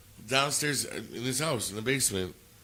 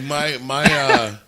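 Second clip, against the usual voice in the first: ordinary talk, nothing marked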